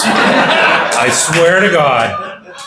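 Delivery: real laughter bursting through the words; laughing. Real, not acted. Sailor telling the Manuel story, laughing hard. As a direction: laugh out loud while swearing it's true. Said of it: "we laughed so hard".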